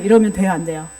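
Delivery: usual speaking voice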